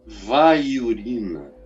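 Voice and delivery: low, measured mock-divine command; a hint of a solemn low divine voice; lightly voiced Holy Spirit. Holy Spirit permitting Charles to pee, lightly mocked low command. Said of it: "quando o Espírito Santo diz".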